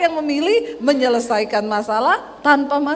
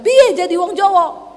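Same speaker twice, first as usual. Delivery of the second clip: louder, higher challenging question; retold retort; 5 semitones higher, 6 dB louder than usual. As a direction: throw the question back, retelling. Retold quarrel with husband. (membalas dengan nada menantang)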